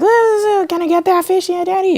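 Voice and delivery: very high put-on little-girl voice; exaggerated high-pitched child plea; Violet. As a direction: squeak it like a kid begging Daddy. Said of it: "my sister Violet's like".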